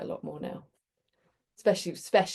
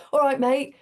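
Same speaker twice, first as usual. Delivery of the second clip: higher, louder friendly call of a passing driver; driver's greeting; 7 semitones higher, 8 dB louder than usual. Retold or imitated. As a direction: sing out a cheery mate greeting. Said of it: "And then he went".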